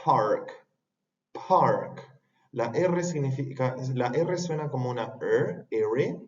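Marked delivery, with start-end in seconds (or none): 0.0-2.1 park with a puff of air on the p; aspirated p. Shown as a demonstration. Teacher modelling park with an aspirated p. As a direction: breathe out hard on the p of park. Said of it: "esto se dice".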